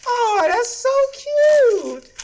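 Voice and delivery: high, very slow cooing; gushing parent. Acted. Dad gushing over his kid's notes, high slow coo.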